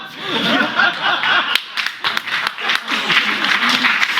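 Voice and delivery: silent high wheeze of laughter; breathy wheezing laugh. Real, not acted. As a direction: laugh hard with no voice. Laughs at Dusty story.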